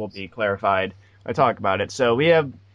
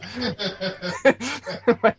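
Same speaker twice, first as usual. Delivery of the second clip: words squeezed out through laughter, much higher; giggling at his own awkwardness; laughing; 13 semitones higher than usual. Real, not acted.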